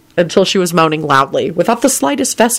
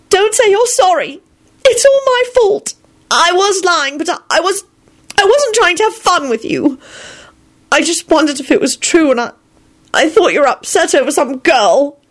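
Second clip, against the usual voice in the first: put-on high-pitched, distressed young woman's voice; tearful; 13 semitones higher than usual. A narrator's reading. Elizabeth confessing to Lupin it's her fault, tearful. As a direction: blurt it out upset, pitched way up. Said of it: "Elizabeth burst into tears".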